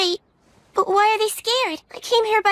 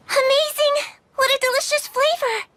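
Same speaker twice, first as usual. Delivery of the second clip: higher, delighted cry over the taste; delighted exclaim; 7 semitones higher than usual. Acted. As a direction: gush over the "flavor". Girl tastes potato mochi.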